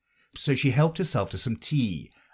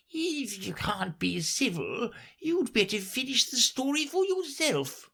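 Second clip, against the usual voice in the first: sulky; 3 dB quieter than usual. Acted. Dormouse sulking at Alice's rudeness. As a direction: pout it out, put out. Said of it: "sulkily remarked".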